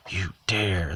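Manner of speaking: understated outraged cry, drawn out on "dare"; indignant cry. A narrator's reading. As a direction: read the vicar's affronted challenge with restraint. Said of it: "the vicar cried".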